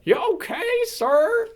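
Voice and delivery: loud, very high Southern holler; hammed-up Southern good Samaritan; southern accent. Guy calls to the crashed driver, loud Southern accent. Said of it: "the guy there is like".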